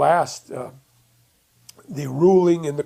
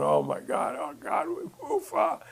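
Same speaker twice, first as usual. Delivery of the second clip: relieved gasps; 14 semitones higher, 7 dB quieter than usual. Retold or imitated. Family hugging in relief.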